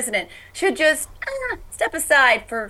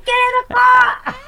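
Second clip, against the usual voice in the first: high, loud, slower fishwife yell; Jersey fishwife yell; 12 semitones higher, 7 dB louder, slower than usual. Retold or imitated. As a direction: holler it at the kids, Jersey mom. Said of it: "Like that".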